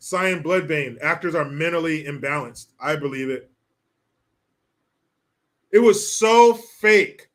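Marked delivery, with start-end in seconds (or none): 5.7-7.3 disgusted outburst. Real, not acted.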